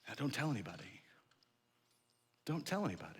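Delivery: low, hushed instruction; quiet warning. Acted. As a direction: keep it secret, softly and firmly. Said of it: "the way the story of the miracle ends".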